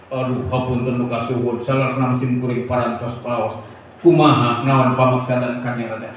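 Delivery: low, subdued courtly reply; deferential minister. Acted. Minister answering the king, low deferential voice. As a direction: answer humbly, voice dropped low.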